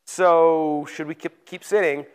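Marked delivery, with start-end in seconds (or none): none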